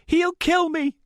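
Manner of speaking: desperate high-pitched screaming plea; loud, very high panicked outburst; voice rising, breaking. A narrator's reading. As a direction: plead at the top of your voice. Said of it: "Rachel's voice rose, broke".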